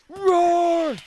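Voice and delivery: long high roaring yell; monster roar. Pretend. Slime roaring at goblins, a loud beastly roar. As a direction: bellow it like a beast.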